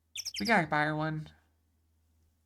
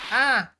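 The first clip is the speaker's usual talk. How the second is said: startled yelp; 4 semitones higher, 9 dB louder than usual. Acted. Startled by the tiger.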